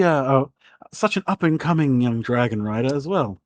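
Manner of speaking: a theatrical aristocrat overjoyed to see a guest; gushing lady. Acted. Lady Torrell answers.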